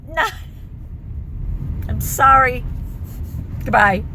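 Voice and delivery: high, loud panicked venting near a shout; anxiety boiling over, can't calm down; real outburst. Real, not acted. Telling viewers she can't cope, panicked loud outburst. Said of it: "Sorry about that outburst".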